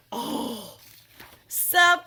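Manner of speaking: high, loud joyful reveal; surprise reveal. Acted. Panda reveals the giant donut, loud and high. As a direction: burst out with the big surprise.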